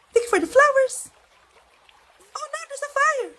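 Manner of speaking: high, sweet voice thanking viewers on live; sugary livestream voice. Acted. Mother on TikTok live thanking viewers, high sugary voice. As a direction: gush at your viewers like a streamer.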